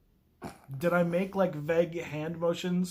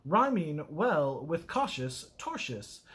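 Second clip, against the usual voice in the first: usual speaking voice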